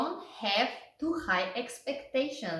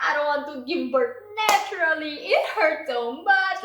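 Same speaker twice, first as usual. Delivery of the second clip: loud, higher pleading for the epidural; desperate pleading; 5 semitones higher, 5 dB louder, faster than usual. Retold or imitated. Mother in labor begging staff for the epidural, loud. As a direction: beg for it like you're in pain. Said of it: "I was saying all the way through".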